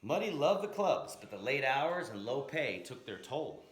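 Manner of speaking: plain reading voice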